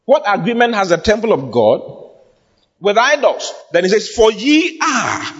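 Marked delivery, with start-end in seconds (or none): 4.1-5.3 "ye are" drawn out slowly, pitched high; emphatic scripture reading. Acted. Preacher quotes the verse to his congregation, emphatic. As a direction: stress "ye" high and stretch out "are". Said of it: "more emphatic about it".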